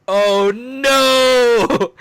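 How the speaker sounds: high, loud hyped "oh no"; excited yelling. Retold or imitated. Reliving the hyped Sans reaction, a high excited yell. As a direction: yell it out in mock alarm while thrilled. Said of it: "I just start yelling".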